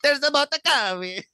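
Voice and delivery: high cartoon Italian accent; hammed-up squeaky Italian accent; Super Mario. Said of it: "what is he, Super Mario?".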